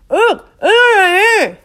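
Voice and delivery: high, loud closed-mouth squeals; startled high squeaking; muffled squeak. A narrator's reading. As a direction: squeal through a mud-caked mouth. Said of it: "she squeaked".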